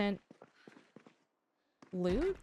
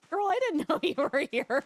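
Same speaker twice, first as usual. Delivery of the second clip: higher, louder through laughter; laughing protest; 6 semitones higher, 5 dB louder than usual. Real, not acted. Streamer laughing at a chatter he didn't know was there, higher and louder. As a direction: protest while cracking up.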